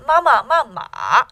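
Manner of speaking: MAMA, MAMA in loud contrasting tones; tone jingle. Shown as a demonstration. Teacher recalling a tone jingle, exaggerated tones on MAMA. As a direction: recite MAMA with each syllable's tone marked. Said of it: "jingle that I taught you".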